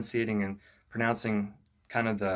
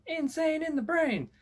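much higher, imitating a rapper's put-on high-pitched voice. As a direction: chant it up high like the hook. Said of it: "It's kinda like a real high-pitched kind of voice".

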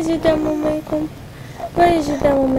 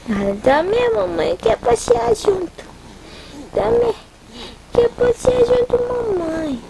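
put-on pleading baby voice; 7 semitones higher than usual. Mother voices baby begging along.

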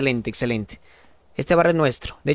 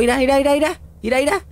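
excited look look; 8 semitones higher, much faster than usual. Real, not acted. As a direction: urge everyone to watch, thrilled. Emocionado viendo al personaje atorado. (emocionado, más agudo y fuerte)

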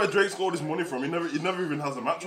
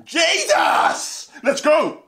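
loud, high-pitched exclamation; hyped shout; 10 semitones higher, 8 dB louder, much slower than usual. Real, not acted. Streamer kicking off a reaction video, hyped shout. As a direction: yell it out, pumped to start.

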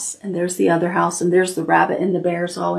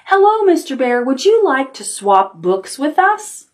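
high, sweet little rabbit asking politely; 6 semitones higher than usual. Acted. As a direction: ask like a hopeful little bunny. Rabbits politely greet grumpy bear.

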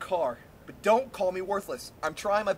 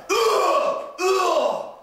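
retching; 10 semitones higher, 6 dB louder than usual. Acted. New guy puking.

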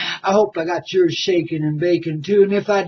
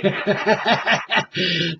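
gleeful chuckle. Real, not acted. Host laughing as whiskey is on its way, gleeful chuckle. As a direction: chuckle at your own joke.

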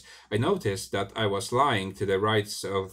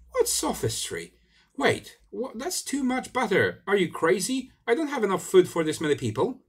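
much higher, rising indignant alarm; the narrator scolding his double over the butter, much higher; alarmed outburst; 12 semitones higher than usual. Acted. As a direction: sound appalled at the waste.

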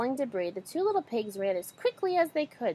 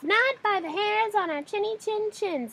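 much higher little pig voices; pigs replying; 11 semitones higher than usual. A narrator's reading. Three pigs safe in the brick house, high squeaky reply. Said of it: "the pigs replied".

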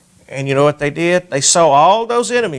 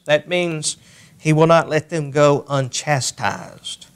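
ordinary delivery

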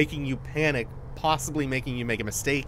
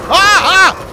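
shrill, loud terrified scream; 14 dB louder than usual. Real, not acted. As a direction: scream at a jump scare. Jump scare hits.

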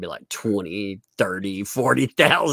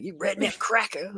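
higher-pitched playful name-calling; imitated heckle, pitched up; mock insult; 12 semitones higher than usual. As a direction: toss out a teasing insult in a higher voice. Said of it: "I love it when people are dropping".

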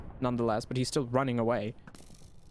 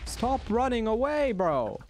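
high, slow, stretched shout; exasperated yell; 10 semitones higher, slower than usual. Real, not acted. Gamer yelling at the boss to stop fleeing.

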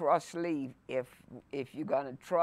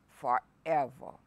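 ordinary talk

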